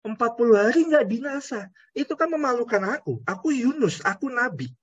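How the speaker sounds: indignant Jonah. Acted. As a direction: complain like a humiliated prophet. Jonah's embarrassed grievance.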